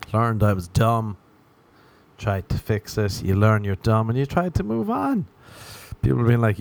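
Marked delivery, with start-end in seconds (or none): none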